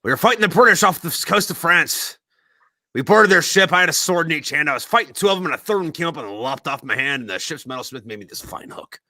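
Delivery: loud, high swaggering pirate tale; boastful old pirate. Acted. Old pirate boasting to the young sailor about his hook. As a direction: boom out the battle story. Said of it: "And he goes".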